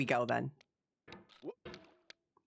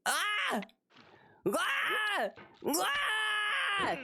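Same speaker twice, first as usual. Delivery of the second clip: high raging screams at the game; real frustrated screaming; much slower than usual. Real, not acted. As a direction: let out shrill gamer rage yells.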